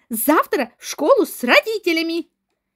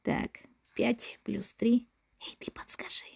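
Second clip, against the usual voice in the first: whispering; 7 semitones lower, 14 dB quieter than usual. Acted. Boy asks hint during lesson.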